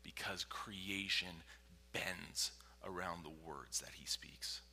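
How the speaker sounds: slow, low near-whisper; hushed awe. Real, not acted. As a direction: let each word fall softly and weightily. Solemn hushed point to congregation.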